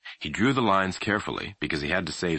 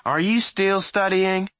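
loud high slow call; 13 semitones higher, 5 dB louder, slower than usual. A narrator's reading. Mother checking on her son upstairs, calling loudly. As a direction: stretch it to carry upstairs.

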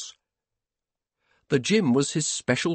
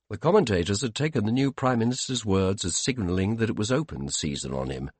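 ordinary delivery, nothing marked

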